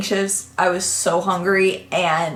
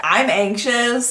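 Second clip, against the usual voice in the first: normal talking voice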